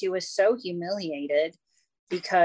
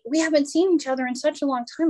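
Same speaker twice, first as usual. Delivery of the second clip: insistent lady; 6 semitones higher, 4 dB louder, faster than usual. Retold or imitated. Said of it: "And she was like".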